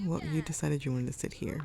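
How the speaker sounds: ordinary talk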